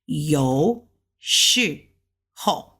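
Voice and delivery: yo low, shi rising, ho falling; Mandarin tones. Shown as a demonstration. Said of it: "So shi is in a second tone".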